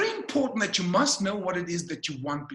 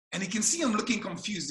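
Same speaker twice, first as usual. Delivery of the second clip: usual speaking voice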